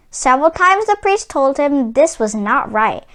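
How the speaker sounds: ordinary delivery, nothing marked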